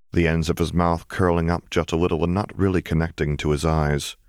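ordinary delivery, nothing marked

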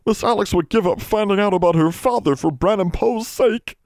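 high, overwhelmed elf voice; squeaky, moved disbelief; gulping elf. A narrator's reading. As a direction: choke up in awe as a little elf. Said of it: "Poe gulped".

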